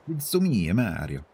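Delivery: high, hammed-up Italian plumber; Mario impression. Discussing voices, streamer bursts into a hammed-up Mario. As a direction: bounce it up like Mario. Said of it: "an Italian accent for a new character".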